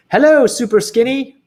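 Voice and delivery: high, loud jeering call; mocking shout. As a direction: tease him across the street. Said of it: "they would shout".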